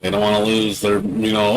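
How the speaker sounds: ordinary talk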